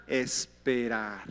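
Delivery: a long, low Esperar, like a held breath; slow drawn-out answer. Acted. Preacher to congregation, a slow, low, drawn-out Esperar. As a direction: stretch the word Esperar out slowly and low.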